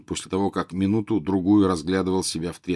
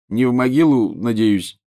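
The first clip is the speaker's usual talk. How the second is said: snorting jibe; 4 semitones higher, 5 dB louder than usual. A narrator's reading. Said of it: "фыркнул Рон".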